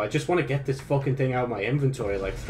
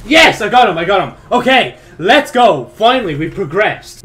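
much higher, much louder triumphant shouting; victory cheer; 6 semitones higher, 11 dB louder than usual. Real, not acted. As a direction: celebrate the kill at full voice. Finally beats the boss.

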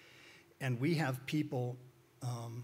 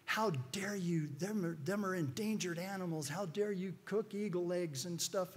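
mock irate; 6 semitones higher than usual. Radio caller scolds host over cooking eagle eggs, mock irate. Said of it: "crazy irate".

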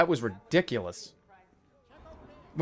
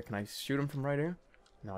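usual speaking voice

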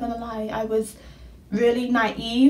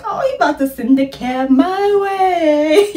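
excited; 6 semitones higher, 5 dB louder than usual. Retold or imitated. Mocking her own excitement.

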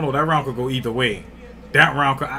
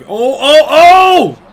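high, loud, rising oh oh OH; excited yell; 14 semitones higher, 16 dB louder, much slower than usual. Real, not acted. Watching the fight, yelling at a big shot with real excitement. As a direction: shout as the punch lands.

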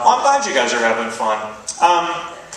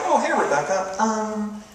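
stuck-up correction; 8 semitones higher, 4 dB quieter than usual. Retold or imitated. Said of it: "stuck-up variations".

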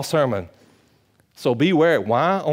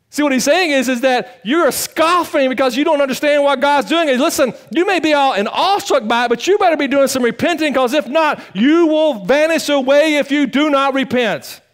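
raised, fervent preaching voice; 11 semitones higher, 5 dB louder than usual. Acted. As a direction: preach it out with conviction.

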